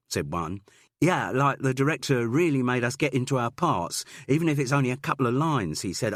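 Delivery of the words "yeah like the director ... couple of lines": higher, louder, fast earnest student; eager drama student. Acted. Student boy tells Jude about the director, eager and fast. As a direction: rattle it off like a keen young actor.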